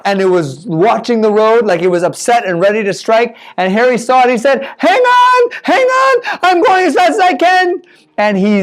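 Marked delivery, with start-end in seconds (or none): 4.8-7.8 high, tense urgent driver's call; urgent shout. Acted. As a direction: push it out fast and high, hanging on. Harry speeding past the cobra.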